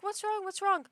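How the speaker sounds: high, anxious repeated question; mock concern. Owner turning over to check her screaming cat, high worried imitation. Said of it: "I'm like".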